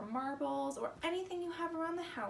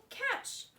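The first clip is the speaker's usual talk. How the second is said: calling catch; 11 semitones higher than usual. Acted. Felix tossing baseball.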